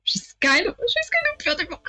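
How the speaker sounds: high-pitched, hurried flutter on "kind of beautiful"; flustered, squeaky admiration; smitten gushing. Acted. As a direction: blurt out a crush in a high voice. Flustered admiration, corrected after.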